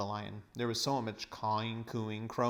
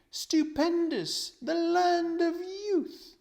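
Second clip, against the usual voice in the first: put-on dreamy old uncle; 5 dB louder than usual. Uncle Andrew dreaming of eternal youth, high and rapturous. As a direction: linger on the land of youth, enraptured.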